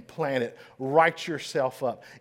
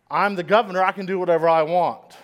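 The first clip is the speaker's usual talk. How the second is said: arrogant retort; 4 semitones higher, 9 dB louder, faster than usual. Retold or imitated. Arrogant governor snaps at aides.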